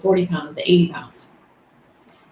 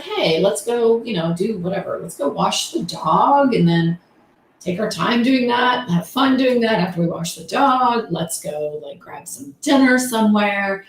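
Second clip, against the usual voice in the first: chill pace; 3 semitones higher than usual. Acted. Mom proposing an unhurried day with her daughter, chill pace. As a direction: stretch it out, in no hurry. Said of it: "a pace that was just more chill".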